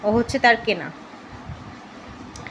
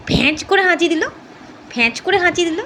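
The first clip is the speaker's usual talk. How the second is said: delighted pet talk; 7 semitones higher than usual. Real, not acted. Owner delighting in her dog's sneeze, high and bright. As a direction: cheer the dog's sneeze like a proud parent. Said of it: "একটু খুঁচিয়ে খুঁচিয়ে একটুখানি ওকে charm করতে হয়".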